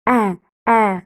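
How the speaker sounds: A, A as open "è", mouth wide. Shown as a demonstration. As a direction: say each A as a separate open "è". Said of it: "On ouvre la bouche". (A prononcé « è », bouche ouverte)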